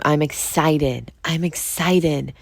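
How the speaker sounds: slow, deliberate self-affirmation. Shown as a demonstration. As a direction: say it slowly, like you mean it. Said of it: "So change it to".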